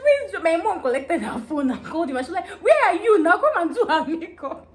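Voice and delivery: loud, high scolding; angry yelling. Retold or imitated. Mother phones the late makeup artist, loud scolding. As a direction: yell down the phone at him.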